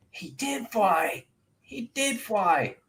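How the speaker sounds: put-on high, slow, amazed children; little voices gasping in wonder; awed little ones. GM voicing the little Dibbons amazed at the flight, high and slow.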